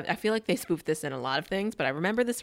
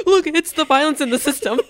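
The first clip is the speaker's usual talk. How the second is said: high, shouted hammed-up complaint; mock protest; 10 semitones higher, 10 dB louder than usual. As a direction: cry out to onlookers, half laughing. Peasant protests being shaken.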